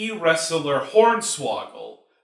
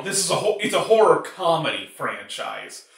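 usual speaking voice

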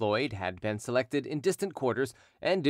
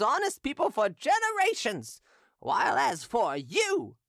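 put-on drunken hawker. Drunken hawker taunting Derues before the crowd, high mocking voice. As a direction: taunt him gleefully like a drunk street seller.

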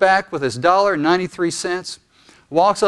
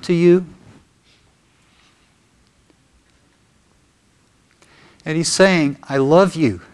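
guided prayer; 2 semitones lower than usual. Real, not acted. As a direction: lead them gently into prayer, leaving room after each phrase. Leads congregation in prayer.

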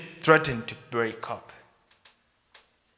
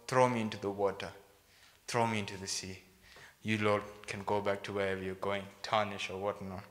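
resigned; 6 semitones lower, 10 dB quieter, faster than usual. Acted. Jonah gives himself up, acted.

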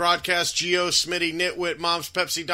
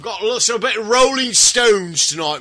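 loud, high, hammed-up British rock star; mock British rocker; 5 semitones higher, 6 dB louder than usual. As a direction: put on a shouty British rocker accent. Mock British rocker bit, "mate.

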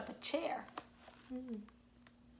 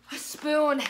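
loud dramatic read; 3 semitones higher, 9 dB louder than usual. Acted. Dramatic reveal in story.

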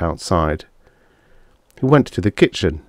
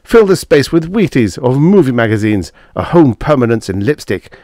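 louder, raised, bitter outburst; snarling; 5 semitones higher, 6 dB louder than usual. A narrator's reading. Husband pulls books off the shelf, snarling. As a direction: fling it back at her. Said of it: "snarled Corey".

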